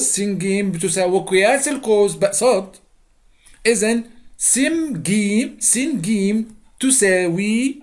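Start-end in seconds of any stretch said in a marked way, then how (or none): 5.6-7.8 تساوي drawn out slowly, syllable by syllable; stress on تساوي. Shown as a demonstration. Teacher correcting equal versus parallel, stressing تساوي slowly. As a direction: stretch تساوي to set it apart. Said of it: "مش توازي".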